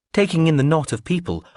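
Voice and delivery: ordinary delivery, nothing marked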